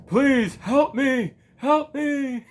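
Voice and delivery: high, drawn-out cry for help; hammed-up begging from a monster's victim; mock desperate plea. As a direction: plead in a slow, high mock panic. Character attacked, pleads for rescue.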